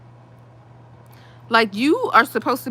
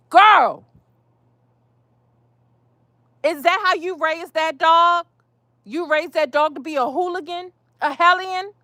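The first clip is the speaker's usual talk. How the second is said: sassy scolding; 6 semitones higher than usual. Pretend. Scolding imagined neighbour.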